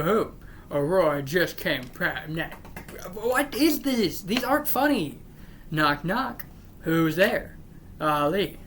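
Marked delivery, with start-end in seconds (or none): 3.1-5.2 high-pitched, incredulous grumble; real exasperation, pitched up; exasperated complaint. Real, not acted. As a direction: complain in disbelief at bad jokes. Breaks off to complain about jokes.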